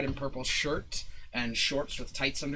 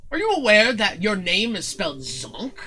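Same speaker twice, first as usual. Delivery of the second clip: higher, theatrical disbelief; haughty showman; 8 semitones higher, 5 dB louder than usual. Acted. Showman questioning Zook about the spelling, haughty. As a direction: query it with pompous puzzlement. Zin questions the misspelt name.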